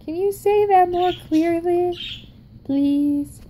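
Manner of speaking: high, plaintive mock plea; pleading learner. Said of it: "I'm just like".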